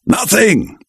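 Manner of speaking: a mild narrated shout, pitched high. A narrator's reading. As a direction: snap out the denial. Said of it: "he shouted".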